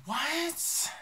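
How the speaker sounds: high, squeaky disbelieving what; shocked what. Retold or imitated. Retelling the audition shock, high startled what. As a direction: yelp it in disbelief. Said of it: "I was like".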